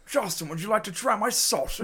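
high-pitched hammed-up offer; changed character voice. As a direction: offer it in a silly put-on voice. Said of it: "why did you change the voice now".

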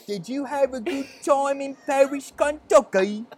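wife asking about the trip; put-on British accent. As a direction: ask sweetly in mock British. Mock British chat.

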